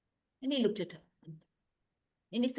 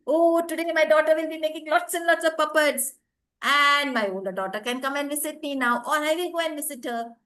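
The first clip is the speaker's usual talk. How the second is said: high, loud cheerful musing; happy mother; 4 semitones higher, 5 dB louder than usual. Acted. Thinking of her daughters, the mother cheers up loudly. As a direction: delight in the sunny day.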